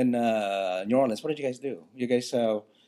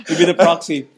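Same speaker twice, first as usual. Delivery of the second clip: ordinary talk, nothing marked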